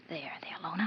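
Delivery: soft soothing unvoiced whisper. [name] whispers to calm her nervous friend Lona. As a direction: hush her nerves under the breath.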